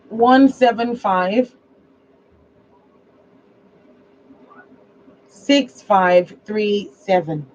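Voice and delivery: each digit spaced out slowly; slow dictation. Shown as a demonstration. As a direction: read the number for someone writing it down. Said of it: "Are you ready?".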